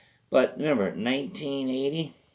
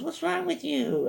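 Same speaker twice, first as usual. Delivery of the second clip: put-on high, scolding girl voice; squeaky shout at the dog. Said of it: "So she goes".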